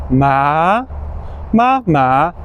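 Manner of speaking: "ma" alternating level and rising tones; tone drill ma. Shown as a demonstration. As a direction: contrast flat and rising "ma". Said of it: "we have first and second".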